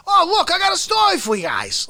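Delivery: high, fast Artie impression; quick raspy Artie try; Artie Lange. Comedian showing his rough Artie Lange impression. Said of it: "just do Artie".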